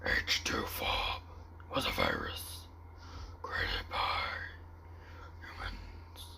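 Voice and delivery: soft, rasping creature narration; [name]'s altered creature voice. Acted. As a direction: explain it haltingly in a throaty whisper. I know it's not like Venom.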